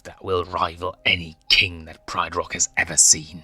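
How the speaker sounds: hissed whisper swelling to a loud proud vow; ruthless lioness mother. Acted. Mother vowing revenge on the Pride Lands, whispered menace building loud.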